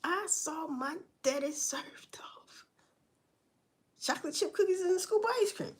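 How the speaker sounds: hushed, breathy imitation of the sister; mock whisper. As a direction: whisper it like a gossiping aunt. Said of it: "the butterific sister said".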